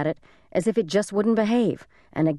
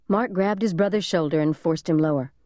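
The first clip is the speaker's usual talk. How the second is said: usual speaking voice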